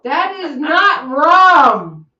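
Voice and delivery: slow, loud, every word spaced; insisting hard, each word weighted; mock protest. Objecting to her friend that the drink is not rum, slow and loud.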